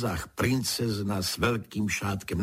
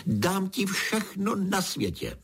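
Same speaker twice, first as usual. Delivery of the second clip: much higher-pitched royal outcry; exclaiming; 12 semitones higher than usual. A narrator's reading. King refuses to give up his belt, exclaiming. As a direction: cry out the offer, without extra volume. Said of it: "zvolal král".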